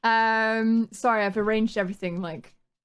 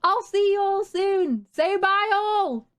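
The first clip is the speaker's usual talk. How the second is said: sing-song goodbye; 8 semitones higher, slower than usual. Real, not acted. Sing-song stream sign-off.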